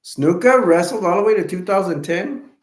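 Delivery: can't believe he wrestled that long; surprised. Real, not acted.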